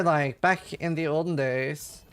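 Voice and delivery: normal talking voice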